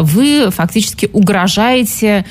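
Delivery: ordinary talk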